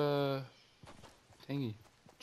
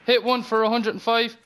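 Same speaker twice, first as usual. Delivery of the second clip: callout shout; 7 semitones higher, 8 dB louder than usual. Real, not acted. Calling out enemy positions.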